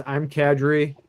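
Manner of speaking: ordinary talk, nothing marked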